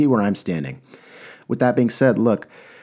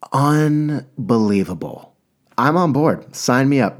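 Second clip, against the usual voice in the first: a slow, drawn-out unbelievable, then a higher, louder burst; excited rave; 5 semitones higher, 4 dB louder, slower than usual. Real, not acted. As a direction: gush with delight after the first sip. Raving about the new drink.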